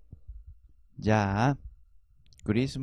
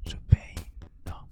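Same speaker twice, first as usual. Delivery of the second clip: whispering; 6 semitones lower than usual. Acted. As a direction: breathe the words, no voice. Whispered shadowing of phrase.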